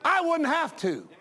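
pastor telling off the parents, heated; angry retort. Retold or imitated. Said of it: "But then I said".

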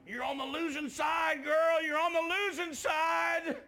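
high, loud sustained holler; full-force pulpit shout, last line drawn out; shouted preaching. Retold or imitated. Re-enacts himself shouting while preaching.

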